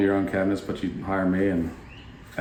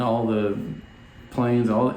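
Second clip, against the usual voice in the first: normal talking voice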